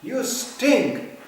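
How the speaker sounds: mock-outraged owner berating the dog; scolding the dog. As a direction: tell off a stinking dog at the door. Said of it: "they are addressed like".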